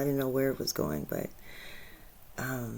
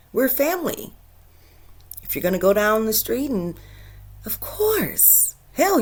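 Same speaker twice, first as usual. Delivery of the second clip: higher, emphatic motherly urging; aunt telling her niece she is free; aunt's earnest talk; 7 semitones higher, 3 dB louder than usual. Retold or imitated. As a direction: lay down the house rules with heart. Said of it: "I said".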